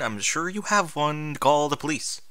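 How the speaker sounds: usual speaking voice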